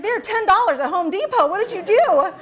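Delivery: loud, high-pitched disbelief; exasperated retold shout. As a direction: imitate a husband exploding at the purchase. Retold husband exasperated at her purchase.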